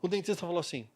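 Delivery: ordinary talk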